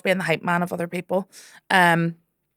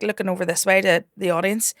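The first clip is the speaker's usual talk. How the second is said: ordinary talk, nothing marked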